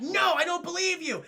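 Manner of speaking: high mock-angry protest; defiant refusal. Brooklyn refusing Demona's offer, defiant. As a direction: throw it back, pitched right up. Acted quarrel.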